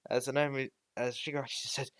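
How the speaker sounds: ordinary delivery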